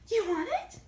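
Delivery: high teasing you want it; high coaxing voice to dog. Real, not acted. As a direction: tease the dog playfully.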